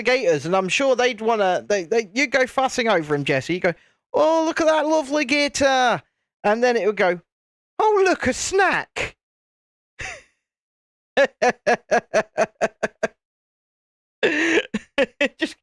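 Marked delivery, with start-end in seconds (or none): 4.1-6.0 high-pitched mock cooing; gushing animal lover. Acts out viewer fussing over gator. 7.8-9.2 very high, slow cartoon delight; hungry gator. Voices gator spotting its prey. 11.2-13.1 real chuckling in a steady string; long laugh. Real, not acted. Laughs at own gator joke.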